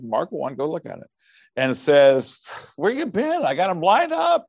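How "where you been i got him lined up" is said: high-pitched, agitated mock disciple; hammed-up exasperation, pitched way up; Peter. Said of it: "Peter goes to him".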